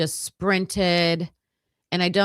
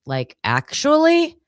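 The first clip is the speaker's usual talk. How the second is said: "Like, actually?" much higher and louder with a big rise; disbelieving question; 9 semitones higher, 5 dB louder than usual. Retold or imitated. Friend texting in shock, loud disbelieving question. Reading a friend's text aloud.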